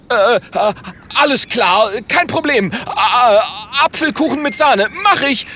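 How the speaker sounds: hohes, verängstigtes Gestammel; stotternd. Acted. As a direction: stammle hastige Zusagen mit hoher Stimme. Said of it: "stottert Franz". (ängstlich stotternd, ganz hoch)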